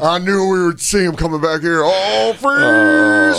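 high, rising, breathless excitement; excited commentator. Pretend. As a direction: squeal it like spotting a monster. Mock nature commentary, high squeal.

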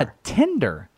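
"tender" said with the short e of ten; "tender" pronounced like ten-der; tender vowel. Real, not acted. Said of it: "that sounded completely normal".

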